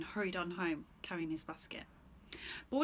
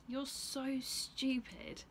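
slow taunt; 5 semitones higher, much slower than usual. Acted. Stephen taunts goat.

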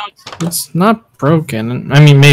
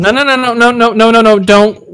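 high rapid-fire string of refusals; high, louder, pleading to stop; urgent objection; 7 semitones higher, 4 dB louder than usual. Acted. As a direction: cut in urgently, pitched high. Stops evidence being burned.

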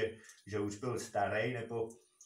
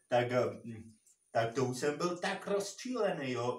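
usual speaking voice

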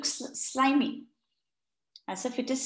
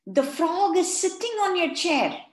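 put-on loud, higher child's warning; urgent child warning; 7 semitones higher, 5 dB louder than usual. A narrator's reading. Farah warns teacher urgently about frog. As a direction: warn him urgently. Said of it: "said Farah".

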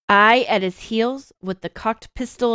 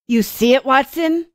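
lightly acted excited yell, rising higher; mild yell; 6 semitones higher, 4 dB louder than usual. A narrator's reading. Holmes calling to Watson in the dark, mild yell. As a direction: call out urgently, without full volume. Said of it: "he yelled".

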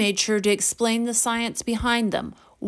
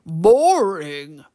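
low, gravelly echo of disbelief; drawn-out, amused creature growl; amused troll; 4 semitones lower, 4 dB louder than usual. A narrator's reading. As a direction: toss the word back in a deep troll voice. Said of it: "Torque chuckled".

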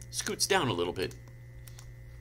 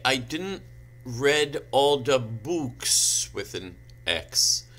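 slow, halting read of mangled writing; deliberate literal reading; 3 semitones higher, 3 dB louder, slower than usual. Acted. Reader voicing the fan fiction author's note, deliberate literal reading. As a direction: sound out every misspelling with indignant emphasis.

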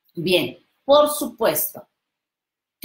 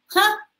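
mocking scoff; 13 semitones higher than usual. Burla envidiosa.

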